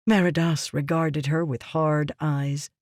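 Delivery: usual speaking voice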